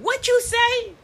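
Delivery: high-pitched mock disbelief; incredulous challenge. Mother to her cursing three-year-old, high incredulous challenge. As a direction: shoot the question way up high.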